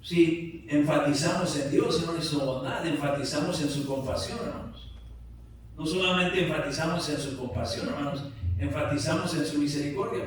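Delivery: loud, insistent emphasis to the congregation; forceful preaching. Real, not acted. Pastor stressing God's compassion and mercy, forceful preaching. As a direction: drive each point home from the pulpit.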